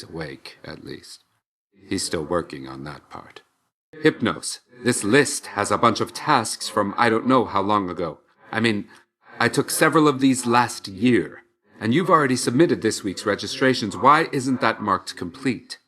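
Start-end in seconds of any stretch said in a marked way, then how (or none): 4.0-15.8 higher-pitched, exasperated telling-off; exasperated scolding. Acted. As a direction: take a colleague to task, pitched up. Scolds Hypnos over his task list.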